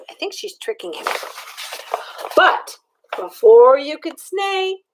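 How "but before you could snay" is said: plain reading voice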